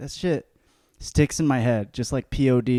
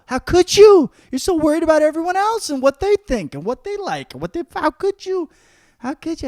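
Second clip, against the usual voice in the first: mock outrage; 7 dB louder than usual. Mock outraged rant at voters.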